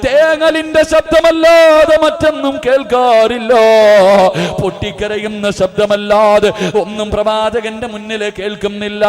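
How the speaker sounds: loud, chanting sermon cry with long-drawn endings; impassioned preaching. Acted. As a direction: preach at full force, stretching the last word of each phrase. Preaches at full force. (ഉച്ചത്തിൽ ഈണത്തിലുള്ള വികാരഭരിത പ്രസംഗം)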